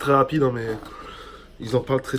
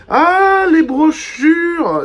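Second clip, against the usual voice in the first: high, delighted drawn-out cry; thrilled to find them; excited; 8 dB louder, much slower than usual. Real, not acted. Delighted discovery while unboxing.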